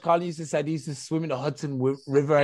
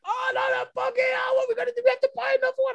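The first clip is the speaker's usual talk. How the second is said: higher, mock-panicked child's wail; lightly voiced eldest daughter. Dad retelling his daughter's reaction to the lost buggy, high mock panic. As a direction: imitate a child fretting, pitched well up. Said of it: "she was just like".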